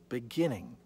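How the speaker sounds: normal talking voice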